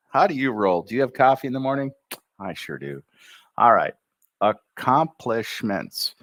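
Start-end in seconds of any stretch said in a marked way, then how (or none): 4.4-6.1 Accomplishments slowed to show its syllables; slowly, letting each syllable of Accomplishments land; syllable breaks. Shown as a demonstration. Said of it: "listen for the changes".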